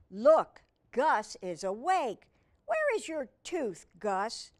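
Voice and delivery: ordinary delivery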